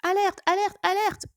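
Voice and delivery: high, loud siren-like alarm call. Pretend. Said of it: "j'avais dit que je mettrais cette petite alerte". (alerte à voix haute et forte)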